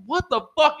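high, incredulous retold outcry; startled disbelief. Hearing it hit the fridge, startled disbelief. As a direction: pitch it way up in bewilderment. Retelling own reaction to the crash.

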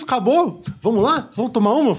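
high, bright mocking invite; high mock cheer. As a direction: ham up the friends' cheer. Retold mates' cheerful invitation.

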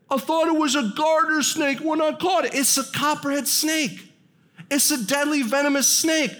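high, dopey admission of a deadly catch; Dan's voice. Retold or imitated. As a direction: play the dim jock realizing it's venomous. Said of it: "that's how he talked".

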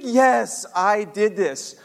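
loud retold admission, slowing down; emphatic admission. Son confirms to dad he did it, loud emphatic admission. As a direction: own up firmly, dragging out the last words.

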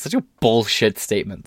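ordinary delivery

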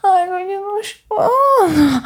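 moaning plea to a lover; aroused moan. Acted. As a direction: let the words ride on a moan.

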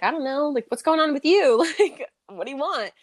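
high-pitched, giggly, thrown-off retelling; flustered retold reply. Telling her co-host how she answered him, high and giggly. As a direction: play it up as caught off guard, laughing.

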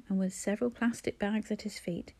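ordinary delivery, nothing marked

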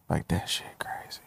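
barely voiced, almost whispered; hushed aside. Telling a friend about the old case, hushed. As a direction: drop to a breathy hush as if in awe.

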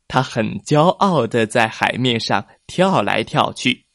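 usual speaking voice

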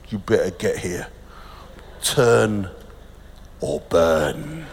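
low, hushed mock menace; mock turn-or-burn preacher. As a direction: imitate a doom preacher, very low and soft. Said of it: "we're not gonna do it in a".

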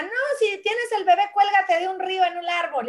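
put-on high, lively old grandma's advice; lightly voiced bossy grandmother; grandmother advising. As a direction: pitch up for granny's folk remedy.

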